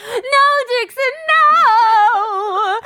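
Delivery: frantic high scream, slow and stretched; shrieking no at the dog; sister screaming. Retold or imitated. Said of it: "I hear my sister screaming".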